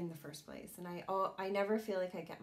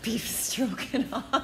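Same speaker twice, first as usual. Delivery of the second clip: slow, louder, laughing through "Beef stroganoff"; laughing punchline; 5 semitones higher, 6 dB louder, slower than usual. Real, not acted. Landing her joke on her partner, laughing. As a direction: crack up while delivering "Beef stroganoff".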